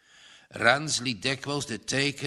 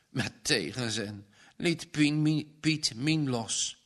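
ordinary delivery

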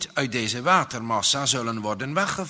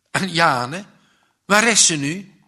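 luid en hoger, angstig; paniekerig; 3 semitones higher, 7 dB louder than usual. A narrator's reading. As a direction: roep bang om haar. Jongen zoekt vriendin, paniek. (angstige vraag)

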